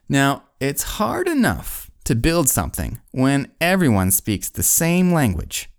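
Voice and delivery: usual speaking voice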